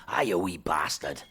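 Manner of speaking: low Scottish key phrase; Scottish accent. Shown as a demonstration. Podcaster showing friends their way into Scots, Scottish accent. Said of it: "to get into the Scottish accent".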